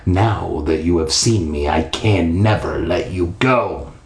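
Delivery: put-on low, ominous old ghost; sea captain's ghost. Old sea captain ghost to Andrew, low ominous voice. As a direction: drop the pitch and pronounce it like a sentence. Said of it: "the sea captain had said".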